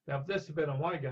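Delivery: ordinary delivery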